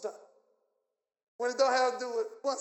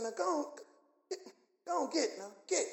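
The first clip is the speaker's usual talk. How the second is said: soft, half-hearted shooing of a dog; imitating a timid command; mild shooing; 3 semitones higher, 7 dB quieter than usual. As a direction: shoo it gently, without authority. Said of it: "That's how you gotta talk".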